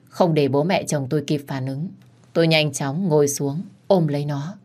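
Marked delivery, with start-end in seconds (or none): none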